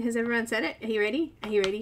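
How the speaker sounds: ordinary delivery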